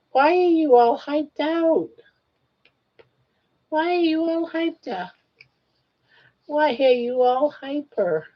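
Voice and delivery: high, playful pet voice. Real, not acted. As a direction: tease the dog sing-song. Teasing the excited dog.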